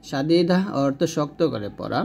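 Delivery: ordinary talk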